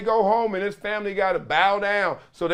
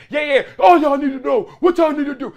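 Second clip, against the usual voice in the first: barking coordinator; 8 semitones higher, 7 dB louder, faster than usual. Retold or imitated. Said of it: "And the coordinators".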